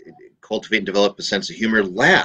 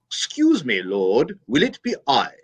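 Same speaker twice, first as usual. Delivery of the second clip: "Excuse me, Lord" in a prim English voice, no brogue; English Judas; 5 semitones higher, slower than usual. Retold or imitated. Judas, the priest's English villain, in a prim English voice. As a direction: make Judas clipped and English. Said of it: "that devil said".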